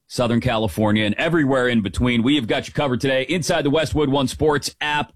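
ordinary talk, nothing marked

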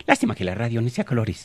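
lightly voiced lady presenter; a hint of a presenter's put-on voice; Gloria Valencia Castaño. Comedian quoting a TV doctora on cameras, light impression. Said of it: "como le diría la doctora Gloria Valencia Castaño".

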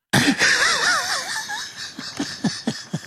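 high wheezing laugh; helpless squealing laughter. Real, not acted. Laughing at his joke about producer.

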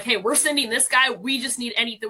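usual speaking voice

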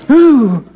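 high, wary little "ooh"; timid lion "ooh". Retold or imitated. Old lion facing a snarling lioness, wary high ooh. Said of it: "this old guy is going".

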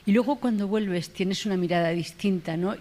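ordinary delivery

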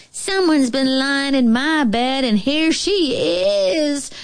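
high cub voice, stretched at the end; high, tattling baby bear; squeaking bear cub. A narrator's reading. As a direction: squeak it as big as a cub can. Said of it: "squeaked the bear cub".

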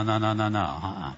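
normal talking voice

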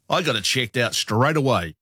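ordinary talk, nothing marked

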